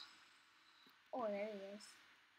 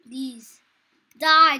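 usual speaking voice

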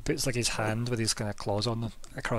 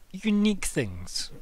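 slow, drawn-out, higher lilt; enigmatic shopkeeper; 7 semitones higher, 3 dB louder, much slower than usual. Acted. Shopkeeper answers what he values, slow and savouring. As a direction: savour it slowly like a mysterious merchant.

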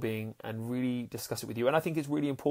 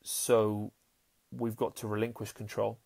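ordinary delivery

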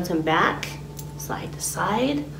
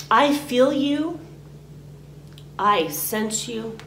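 slow and higher on "I feel you", then "I sense you" settling; slow, deliberate affirmation; 4 semitones higher, slower than usual. Shown as a demonstration. Teacher shows the words to say to what you release, slow and deliberate. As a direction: say each phrase slowly, letting it land. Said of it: "You can say".

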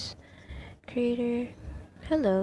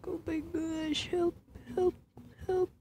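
panicked; 9 semitones higher than usual. Real, not acted. Panicking in horror game.

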